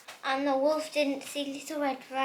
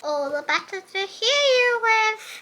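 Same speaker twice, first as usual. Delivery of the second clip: high, loud sly wolf voice; wolf in granny's cap, high and loud; 9 semitones higher, 9 dB louder than usual. Acted. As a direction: purr it like a wolf in disguise.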